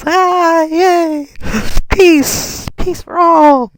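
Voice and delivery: squeaky tipsy king celebrating; drunk idiot king. Retold or imitated. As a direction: play the dad as a happy drunk fool. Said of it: "Looks like he's drunk".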